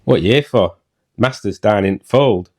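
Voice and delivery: low-pitched broad Yorkshire reading; Yorkshire dialect. Shown as a demonstration. Husband reading Joseph's lines for his wife, Yorkshire dialect. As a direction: read it in deep Yorkshire dialect. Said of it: "I can't do Yorkshire".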